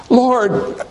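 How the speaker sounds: very high, desperate plea; anguished acted cry, halting then insistent; agonized. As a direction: beg to be believed, pitch way up. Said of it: "in agony".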